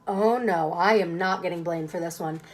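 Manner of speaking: plain reading voice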